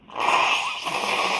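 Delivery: stuffy nose before blowing it. Real, not acted. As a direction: talk through a blocked nose. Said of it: "gotta blow my nose".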